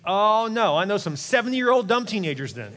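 ordinary talk, nothing marked

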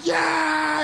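high, drawn-out celebratory yell; excited cheer. Retold or imitated. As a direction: cheer like a thrilled teammate. Teammates celebrating a tackle.

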